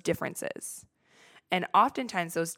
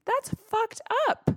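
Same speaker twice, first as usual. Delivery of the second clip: much higher, indignant "That's fucked up."; mock outrage. Shown as a demonstration. Scolding her own insecurity, a much higher indignant voice.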